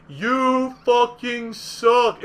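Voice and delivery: drawn-out loud jeer; megaphone taunt. Retold or imitated. Imagining a megaphone in your face, slow loud jeer. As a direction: stretch it out, loud and mocking. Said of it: "putting a megaphone directly in your face and going".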